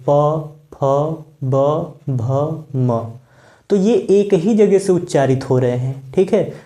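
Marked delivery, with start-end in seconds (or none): 0.1-3.0 "प, फ, ब, भ, म" one by one with pauses; lip sounds demonstrated. Shown as a demonstration. Teacher shows sounds made with lips, "प, फ, ब, भ, म" spaced out. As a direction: separate each of "प, फ, ब, भ, म", formed on the lips. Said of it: "आप ओठों की सहायता से करेंगे".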